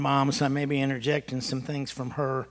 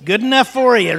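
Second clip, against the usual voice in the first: higher, louder smug told-you-so mom; mocking mother; 8 semitones higher, 11 dB louder than usual. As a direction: scold with glee, I told you so. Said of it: "And here's my mother".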